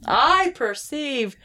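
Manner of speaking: mock-grand announcement. Player declaring a failed perception check, mock-grand.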